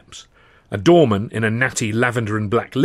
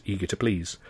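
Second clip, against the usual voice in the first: plain reading voice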